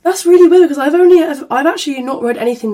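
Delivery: high, loud surprise; surprised. Real, not acted. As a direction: exclaim it in disbelief. Surprised at bad reviews.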